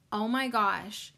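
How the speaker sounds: ordinary talk, nothing marked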